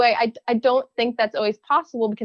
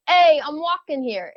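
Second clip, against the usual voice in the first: loose attempt at a Brooklyn accent, drawn out and higher; 5 semitones higher than usual. Shown as a demonstration. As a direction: try a Brooklyn street call-out, slowly. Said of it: "Give me your best Brooklyn accent".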